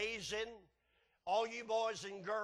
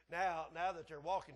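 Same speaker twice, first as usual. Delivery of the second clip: usual speaking voice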